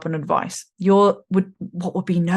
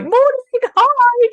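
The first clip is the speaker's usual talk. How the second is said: much higher, bright sing-song hello to everyone; chirpy greeting; 6 dB louder, much slower than usual. Retold or imitated. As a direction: greet the whole world like you're lit up. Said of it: "You're like".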